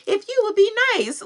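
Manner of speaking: high, bright mock game-show host; mock TV prank host. Imagining a prank show host, high announcer voice. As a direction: play the hidden-camera host revealing the prank. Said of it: "to come out like".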